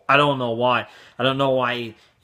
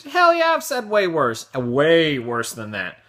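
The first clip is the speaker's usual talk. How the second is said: gleeful admission; 3 semitones higher, slower than usual. Real, not acted.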